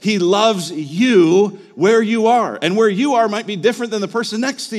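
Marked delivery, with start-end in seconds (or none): 0.0-2.6 slow, loud, higher declaration; emphatic preaching. Real, not acted. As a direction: drive it home slowly to the congregation. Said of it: "Listen, friends".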